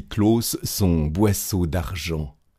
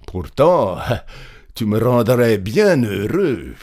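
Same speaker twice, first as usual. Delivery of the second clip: insistent plea; 2 semitones higher, 4 dB louder than usual. A narrator's reading. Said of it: "dit le paysan en insistant".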